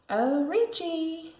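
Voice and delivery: high, slow mock-sweet call. A narrator's reading. Sister confronting her brother at recess, mock sweet. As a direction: lure him in sweetly.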